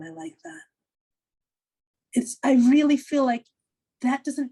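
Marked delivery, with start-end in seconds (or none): 0.0-0.6 soft whisper; a small private whisper; hushed, half to yourself; whispered to herself. Retold or imitated. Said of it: "And I'm like".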